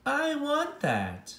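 greedy little voice, slowly; demanding character voice. Acted. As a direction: whine for it like a spoiled child.

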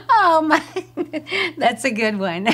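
breaking up with laughter as you speak; laughing. Acted. Receptionist taking applicant's name as a joke, laughing. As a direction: talk through the last of a laughing fit.

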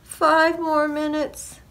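high, slow plea from bed; pleading farmer. A narrator's reading. Farmer bargaining to stay in bed, pleading.

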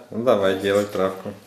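usual speaking voice

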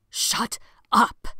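loud furious shout; wild furious. A narrator's reading. Sebastian furiously silencing Tony. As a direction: snap with wild fury. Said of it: "voice was wild and furious".

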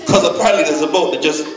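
ordinary talk, nothing marked

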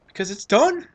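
loud, high proud exclamation; triumphant. Real, not acted. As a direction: shout the relief of finishing. Triumph over finished edit.

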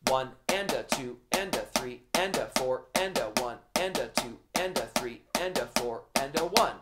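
soft steady counting in time with the drums; quiet metronome-like count; counting aloud in rhythm. Shown as a demonstration. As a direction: chant the counts quietly and evenly. Counts along with students.

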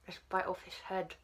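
muffled chewing sounds. Real, not acted. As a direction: mouth full of candy. Seen 3: bites gummy at mouth, then chews with lips closed, holding rest.

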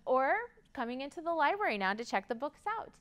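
plain reading voice

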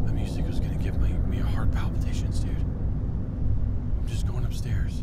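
breathless, tense whisper. Real, not acted. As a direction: hush it so the monster won't hear. Spooked by horror game music.

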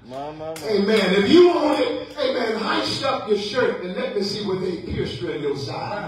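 high-pitched, climbing sermon climax; impassioned preaching. Acted. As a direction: challenge the congregation with full conviction.

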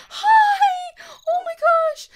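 high, loud squealing hello; delighted squeal. Real, not acted. Gamer greeting a tiny dog, squealing happily. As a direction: burst out in joy.

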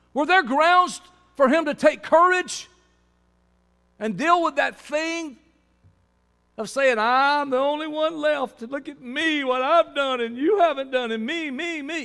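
high-pitched sermon at full force; impassioned preaching. Acted. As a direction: cry it out at the top of the voice. Preacher mocks Elijah's self-pitying complaint.

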